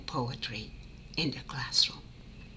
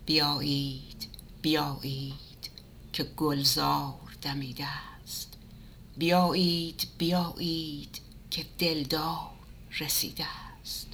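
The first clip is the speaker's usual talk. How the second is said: rhythmic Persian recitation fading to a whisper; poem recitation; much faster than usual. Acted. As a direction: call out the verse, then hush it.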